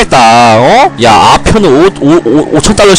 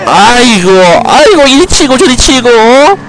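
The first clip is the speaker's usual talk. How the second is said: hammed-up lament, much higher pitched; mock wailing; 8 semitones higher than usual. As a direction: wail about hardship, half-joking. Wailing about hard life.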